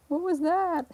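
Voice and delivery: high, loud startled question; surprised. Real, not acted. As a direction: caught off guard by the cat. Surprised by the cat.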